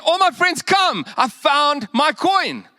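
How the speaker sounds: high, slow, drawn-out call; joyful call to friends. Retold or imitated. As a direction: call the friends over, overjoyed. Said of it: "And, and she says".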